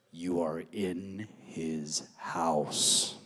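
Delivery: low, very slow, otherworldly medium voice; mock spooky medium. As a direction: drag it out like a psychic channelling a ghost. Spiritualist relays ghost's message.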